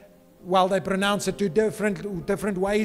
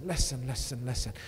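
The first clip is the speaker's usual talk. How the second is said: fading listen; 6 semitones lower, 6 dB quieter than usual. Real, not acted. Preacher draws the crowd in.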